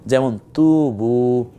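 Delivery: তুবু slowly, তু held long. Shown as a demonstration. Quran teacher showing students the long vowel. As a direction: draw out তু in তুবু. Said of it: "এই যে তুতে টানলাম কেন?".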